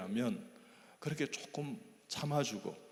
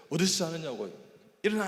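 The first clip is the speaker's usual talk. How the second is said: mock angry yell; 5 semitones higher, 6 dB louder than usual. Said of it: "다리를 쫙 차면서요".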